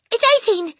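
high, loud panicked yell; Rupert crying out. A narrator's reading. Boy answering the menacing headmistress, high loud cry. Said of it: "he cried".

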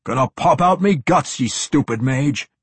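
loud, high dwarf complaint; gruff dwarf. A narrator's reading. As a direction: bellow it like an irked dwarf.

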